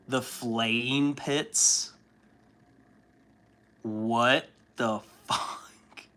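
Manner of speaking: very slow, drawn-out disbelieving repeat; stunned disbelief. Retold or imitated. Reader reacting to the flaying pits, slow stunned disbelief. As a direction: let it sink in slowly, baffled. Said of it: "And I was like".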